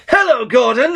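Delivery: high, sing-song villain greeting; gleeful Joker. Retold or imitated. Joker cheerfully greeting Gordon, high and gleeful. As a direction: chirp it out like a giddy maniac. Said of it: "the Joker came up and was like".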